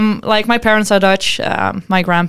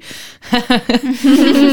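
short nervous giggle; nervous laugh; 3 semitones higher than usual. Real, not acted. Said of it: "Nervous laugh, I know that".

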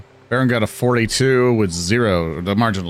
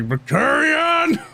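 long, high-pitched shout of a name; enraged yell; much slower than usual. Acted. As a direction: bellow the name in drawn-out rage. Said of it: "just like a reverence".

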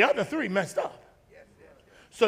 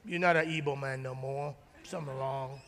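disapproving relative; 8 semitones lower, 9 dB quieter than usual. Retold or imitated. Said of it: "you go home and say".

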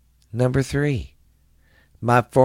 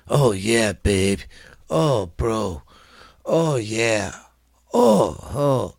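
surfer dude; slower than usual. Retold or imitated. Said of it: "That dude sounds like a surfer, bro".

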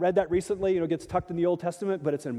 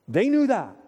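emphatic; 5 semitones higher, 6 dB louder than usual. Real, not acted. Preacher drives home point.

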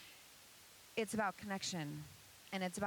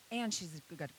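ordinary delivery

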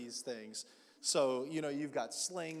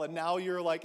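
ordinary talk, nothing marked

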